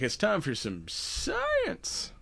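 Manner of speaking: slow, high southern twang; southern accent. Acted. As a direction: drawl it like a southern host. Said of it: "you put on your southern voice".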